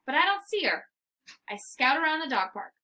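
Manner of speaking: ordinary delivery, nothing marked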